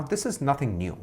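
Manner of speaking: ordinary talk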